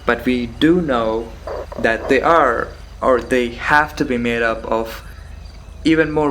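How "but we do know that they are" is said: normal talking voice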